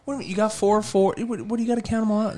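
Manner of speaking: high, fast incredulous protest; excited protest. Real, not acted. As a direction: push back, pitch shooting up. Disputing the score count.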